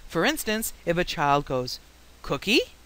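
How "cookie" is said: "Cookie" rising at the end; lightly imitated request, "Cookie" lifting into a question; child's one-word question. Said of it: "because of prosody".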